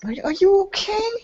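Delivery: put-on high, creaky old woman fussing; high cranky old voice, half laughing. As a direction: play a cranky old lady fretting over a fallen child. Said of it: "a cranky old voice saying".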